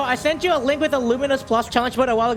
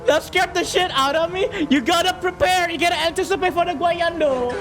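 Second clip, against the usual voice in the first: relieved laughter; 5 semitones higher than usual. Real, not acted. Still laughing after the scare.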